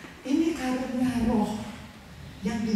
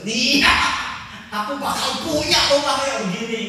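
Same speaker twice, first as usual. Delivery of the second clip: loud, excited claim; shout of faith; 5 semitones higher, 6 dB louder than usual. Shown as a demonstration. Believer seeing a fine house, excited loud claim. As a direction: exclaim it with faith. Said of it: "teriak lagi beriman".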